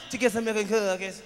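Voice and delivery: lightly voiced little kid; imitating the kid. Comedian mimicking the six-year-old's answer, lightly voiced. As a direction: mimic the boy's small reply. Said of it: "That's how he said it".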